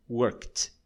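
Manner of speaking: worked with a clear final T. Shown as a demonstration. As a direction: say "workt" in one syllable. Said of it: "vai pronunciar como T".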